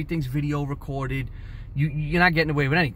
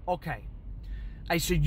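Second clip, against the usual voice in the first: normal talking voice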